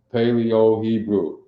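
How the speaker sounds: ordinary talk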